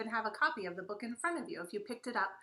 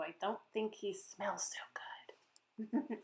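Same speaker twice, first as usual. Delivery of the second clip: slow, secret whisper; whispered aside; 6 dB quieter, slower than usual. Acted. Author confiding to children, slow whisper. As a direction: lean in and whisper to the kids.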